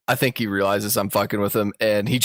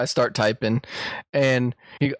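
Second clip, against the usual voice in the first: normal talking voice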